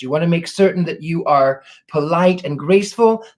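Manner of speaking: normal talking voice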